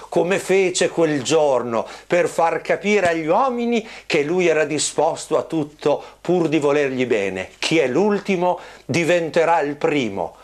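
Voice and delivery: ordinary delivery, nothing marked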